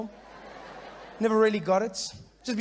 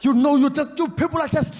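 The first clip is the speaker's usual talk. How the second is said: higher, louder protest rant building to a shout; angry protester; 7 semitones higher, 5 dB louder than usual. Retold or imitated. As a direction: rant at the bench and bus rules, climbing to a yell. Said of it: "The first guy standing there going".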